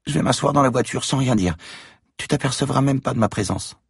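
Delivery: put-on high child voice, telling a secret; little girl voice. A narrator's reading. Girl tells Franck about the voices, high child voice. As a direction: voice a scared child, high-pitched.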